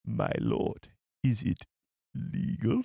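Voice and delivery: low drop on my lord, whispered legal; Nute Gunray voice. Pretend. Streamer repeating the line for chat, low then whispered. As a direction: mimic the alien aide, low then breathy. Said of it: "Newt Gunray".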